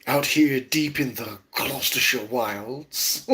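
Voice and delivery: slow, hushed nature-documentary narrator; terrible put-on Attenborough voice; Attenborough impression. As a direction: narrate the garden like a wildlife film. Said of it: "I have a terrible Attenborough impersonation".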